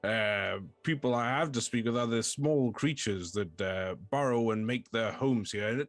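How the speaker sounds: mannered, theatrical character voice; fussy, courteous stone head starved of company; bored lonely fort. Acted. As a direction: complain politely with swooping emphasis. Game master plays the fort.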